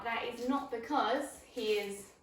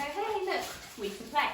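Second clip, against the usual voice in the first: "Hey, look" lifted high and loud, upbeat; excited invite to play; 5 semitones higher, 4 dB louder than usual. Shown as a demonstration. Owner to puppy, restarting the game: excited, high and loud. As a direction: call the puppy back in with a bright, playful lift. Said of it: "pick up the toy again and go".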